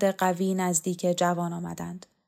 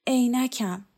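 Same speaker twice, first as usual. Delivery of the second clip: groaning; 5 semitones higher, slower than usual. A narrator's reading. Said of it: "دانشجو نالید".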